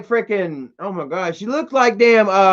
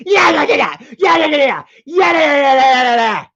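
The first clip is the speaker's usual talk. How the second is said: high, louder crying yell; high mock screaming and crying; imitating her screaming; 6 semitones higher, 4 dB louder than usual. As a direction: wail it out like a frantic woman. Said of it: "I heard Lauren".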